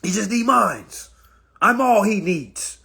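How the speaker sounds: high, loud possessive woman; mock jealous girlfriend. Mocking a possessive girlfriend, high loud voice. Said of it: "a lot of women don't like that".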